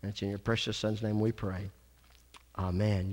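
soft, hushed prayer voice; closing a prayer quietly, head bowed. Real, not acted. Pastor closing prayer to congregation, soft and hushed.

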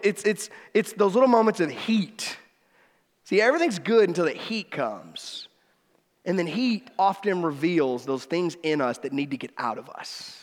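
normal talking voice

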